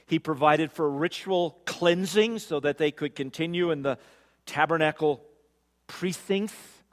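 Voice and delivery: loud, high, ringing preacher's voice; sermon build, proclaiming loudly; preaching at full force. Acted. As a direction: drive it home to the congregation.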